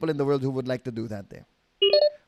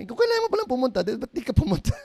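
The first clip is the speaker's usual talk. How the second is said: mock wife; 10 semitones higher, 3 dB louder, faster than usual. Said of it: "Sabi niya".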